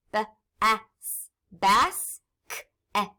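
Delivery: "b-a-s, bas-k-et" sound by sound, each half blended after. Shown as a demonstration. Teacher cutting basket in half, "b-a-s, bas-k-et" sounded out. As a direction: separate each letter sound in "b-a-s", then in "k-et". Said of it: "I can cut them in half".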